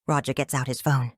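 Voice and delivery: ordinary delivery, nothing marked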